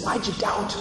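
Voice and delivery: soft, high tender chiding; gentle Jesus rebuke. Retold or imitated. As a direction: lovingly ask why he doubted. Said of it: "You can imagine the tone".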